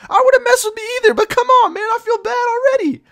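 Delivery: very high, loud squeaky plea; comic anguish. Acted. Kid who cut the line feeling bad, squealed in anguish. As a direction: wail it way up high, mock-desperate. Pleading after cutting the line.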